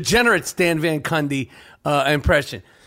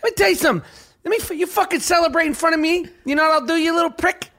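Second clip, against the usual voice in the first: high, tough-guy coach snapping at a player, fast; a furious little mob boss lecturing the kid; Tom Izzo impression; 8 semitones higher, much faster than usual. Host mocking coach who scolds a celebrating shooter, high and fast. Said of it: "my Tom Izzo impression that I'm perfecting".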